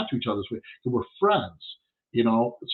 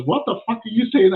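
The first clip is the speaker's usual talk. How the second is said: friend's quote, much higher and louder, caught off guard; startled outburst; 10 semitones higher, 5 dB louder than usual. Retold or imitated. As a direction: blurt it out, bewildered. Friend's startled retort, retold.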